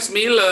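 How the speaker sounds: "smile" in two syllables, final e voiced; Indonesian spelling pronunciation. Shown as a demonstration. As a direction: smile read as smi-le with an ee sound. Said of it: "Orang Indonesia bilangnya smile".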